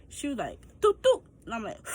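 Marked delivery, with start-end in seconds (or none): 0.8-1.2 high, loud doop doop for the stapler; staple gun noise. Retold or imitated. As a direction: pop out two quick stapler sounds.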